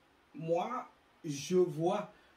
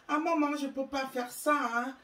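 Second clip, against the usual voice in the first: put-on higher, affected whiny child voice; mock spoiled child; 9 semitones higher, 5 dB louder than usual. Spoiled child answering mother, mocked in higher affected whine. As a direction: parody the child's put-on reply. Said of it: "Et l'enfant".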